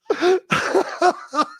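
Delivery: a high laugh bursting out; high burst of laughter. Real, not acted. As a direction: crack up laughing.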